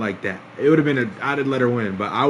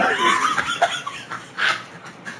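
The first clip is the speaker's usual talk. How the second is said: high, wheezing helpless laugh; laughing fit; 3 dB quieter than usual. Real, not acted. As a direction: lose it at your own slip. Catches own double meaning.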